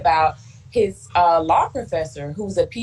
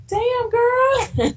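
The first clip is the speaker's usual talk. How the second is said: high, playful mock-mom exclamation; mom's impressed quip. Mom teasing her daughter after she gets out of the car, high and playful. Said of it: "She was like".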